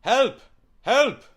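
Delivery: very high imitated cry for help; imitating frantic lady. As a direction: mimic a panicked customer calling out. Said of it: "she comes up to me and just goes".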